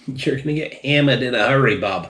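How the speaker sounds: put-on a clumsy first try at a Down East Maine accent; bad Down East accent. Reviewer warns viewers about strong tea in a botched Down East accent. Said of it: "the worst fucking down Easter accent".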